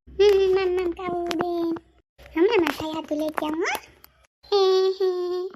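put-on high, sing-song baby talk to a toddler. Grandmother cooing baby talk to her grandson. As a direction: coo at the little one in baby words.

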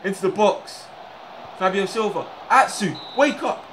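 high, loud urgent calls; excited yelling. Real, not acted. Streamer shouting at his winger, excited yelling. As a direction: urge the attack on.

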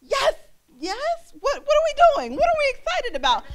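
very high excited squeal; loud clueless cheering. Retold or imitated. Joining a crowd without knowing why, loud excited cheering. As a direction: cheer loudly, then ask what for. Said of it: "At first, it's just loud".

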